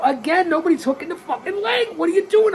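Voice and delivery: at the end of his patience; exasperated yell. Real, not acted. Yells as leg not hooked again.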